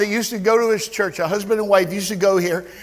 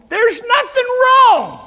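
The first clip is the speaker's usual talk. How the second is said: slow, much higher, louder cry; preaching climax; 12 semitones higher, 5 dB louder, slower than usual. Real, not acted. Preacher assuring the congregation there is nothing wrong, high and slow. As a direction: lift it high and drag it out.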